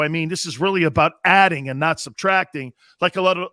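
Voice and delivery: usual speaking voice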